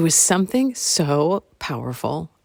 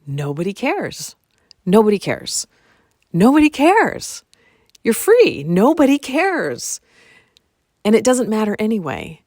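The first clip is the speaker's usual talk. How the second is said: building to joyful release; 6 semitones higher, 4 dB louder than usual. Shown as a demonstration. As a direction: let each repeat lift toward elation. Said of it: "I felt this freedom and joy".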